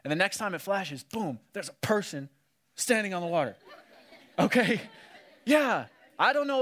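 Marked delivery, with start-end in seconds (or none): none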